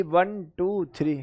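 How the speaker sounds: normal talking voice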